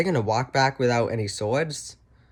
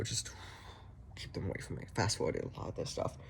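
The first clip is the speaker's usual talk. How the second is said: unvoiced whisper, much softer; whispered aside; 4 semitones lower, 15 dB quieter than usual. Real, not acted. Vlogger whispering an aside about the hobbits.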